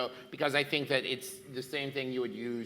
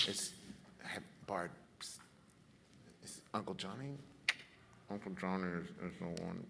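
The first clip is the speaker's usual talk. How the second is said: hushed, much softer aside to the bard; whispering aside; 5 semitones lower, 7 dB quieter than usual. Acted. Paladin whispers to the bard about Uncle Johnny. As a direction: lean in and keep it secret.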